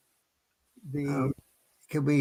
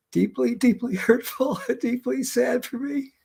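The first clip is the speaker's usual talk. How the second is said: voice breaking with grief; 11 semitones higher than usual. Real, not acted. Grieving friend's death.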